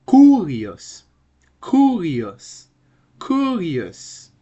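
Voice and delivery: "kurios" with a loud, high, long KU; pronunciation model. Shown as a demonstration. Teacher drilling students, "kurios" with a high, loud first syllable. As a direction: punch and stretch the first syllable of "kurios", then fall. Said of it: "What about this?".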